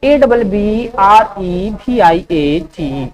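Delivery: slow letter-by-letter dictation; slow spelling dictation; spelling out slowly. Shown as a demonstration. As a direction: spell each letter clearly for students. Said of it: "abbreviate का spelling क्या हुआ".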